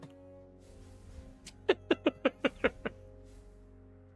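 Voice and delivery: airy silent laugh; breathy laugh. Real, not acted. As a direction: laugh under your breath. Laughs at his failed stealth.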